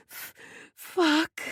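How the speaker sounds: high, raw strained cry. A narrator's reading. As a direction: let it tear out of a raw throat. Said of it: "I cry, my voice raw".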